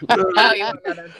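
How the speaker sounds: loud, laughing retort. Real, not acted. Guest laughing at the host's two weeks joke, loud. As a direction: laugh it out as you answer.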